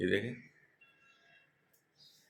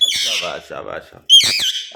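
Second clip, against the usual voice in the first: loud praise; 5 semitones lower, 11 dB louder, slower than usual. Real, not acted. Praising bird that obeyed.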